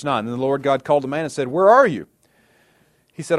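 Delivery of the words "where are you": loud, high call. Acted. God calls to the man in the garden, calling out. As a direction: call out as God searching for someone hiding.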